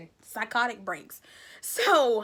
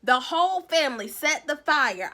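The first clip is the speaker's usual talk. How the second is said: ordinary talk, nothing marked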